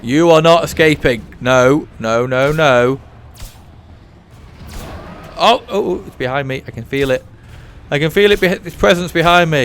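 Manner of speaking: loud high panicked voice; panic, something behind you; being chased. Real, not acted. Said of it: "that panic that you were hearing".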